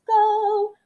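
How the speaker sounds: high, loud cheer; excited cheer. Real, not acted. Teacher cheering the class on, loud and high. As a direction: burst out with it.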